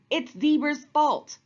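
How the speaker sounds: high, loud accusing cry; storybook alligator screaming blame. A narrator's reading. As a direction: point the finger, shouting high. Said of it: "screamed Alligator".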